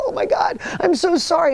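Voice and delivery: high, panicky mock apology; imitating a shaken woman. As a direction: gasp out a frantic apology in a high voice. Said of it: "she's shaking, it's like".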